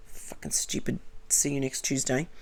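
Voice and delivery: low whisper under the breath; whispered swearing. Real, not acted. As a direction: whisper the curse as a private aside.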